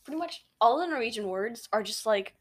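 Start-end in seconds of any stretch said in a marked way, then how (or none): none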